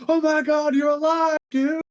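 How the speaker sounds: shouting with joy, high and loud; excited shout. Acted. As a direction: yell it like you can't believe he's alive. Townsfolk greet returning friend.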